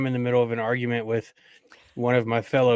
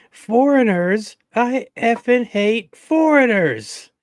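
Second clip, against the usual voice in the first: high, loud, slow, drawn-out mock rant; much higher, louder caricature voice, stretching "foreigners"; Waiting character impression; 11 semitones higher, 7 dB louder, much slower than usual. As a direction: ham up a bigoted movie character's outburst. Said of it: "what's her name from, um, from Waiting?".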